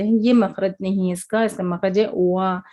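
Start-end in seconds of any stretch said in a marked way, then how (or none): 2.1-2.6 long वा as "waa", lips in an O; rounded-lip W. Shown as a demonstration. Teacher showing where the letter is made, rounded "waa". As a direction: round both lips and glide out slowly on वा. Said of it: "दोनों होठों को गोल shape".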